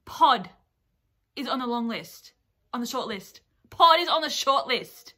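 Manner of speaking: loud, stunned exclamation; real indignant astonishment at full volume; outraged disbelief. Real, not acted. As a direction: blurt it out in loud shock, building to the last repeat. Shocked by the shortlist.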